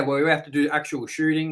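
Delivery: ordinary talk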